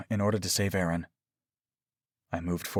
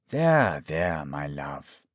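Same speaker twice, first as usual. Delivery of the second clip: tender; 6 semitones lower, much slower than usual. A narrator's reading. Doctor caressing his lover at gunpoint, tender. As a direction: comfort him slowly, letting the voice drop. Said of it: "kissed his face tenderly".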